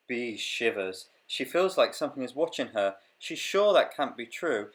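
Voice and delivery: lively storybook reading voice; reading to children. Acted. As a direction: read it like a picture book. Reads picture book aloud.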